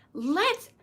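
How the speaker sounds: lightly imitated three-year-old, high; tiny toddler voice. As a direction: start off like a cute little toddler. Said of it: "his tiny little cute little toddler three-year-old voice".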